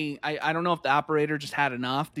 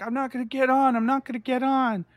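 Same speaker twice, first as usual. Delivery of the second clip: high-pitched whiny imitation of a child; wailed mock refusal, pitched up; mock crying tantrum; 7 semitones higher than usual. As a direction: imitate a little girl crying in protest. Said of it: "crying because she's like".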